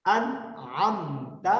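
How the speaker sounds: انعمت drawn out with a clear n; recited very slowly. Shown as a demonstration. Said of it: "آپ میرے ساتھ پڑھیں". (انعمت بغیر غنہ کے آہستہ)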